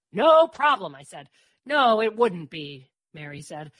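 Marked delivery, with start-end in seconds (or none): 0.1-0.9 high, loud, confident reply; eager assurance. A narrator's reading. Dor assures Murph about parents, eager and loud. 1.6-2.9 slow, louder, weighty; emphatic agreement. A narrator's reading. Mary comments on Dor's neglectful parents, emphatic.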